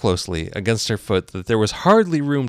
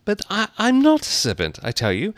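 high, halting objection; lightly voiced flustered girl; protesting Alice. Alice protests to accusing pigeon.

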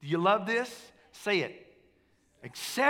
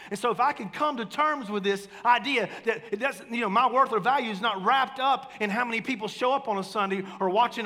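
louder, higher, pressing each phrase; fervent preaching; 5 semitones higher, 5 dB louder than usual. Acted. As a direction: proclaim it, building to the amen. Pastor's fervent confession.

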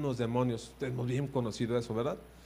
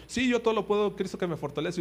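raised, emphatic verse-quoting; mock bold declaration; 5 semitones higher than usual. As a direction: declare it with showy confidence. Acting Christians boldly declaring a verse.

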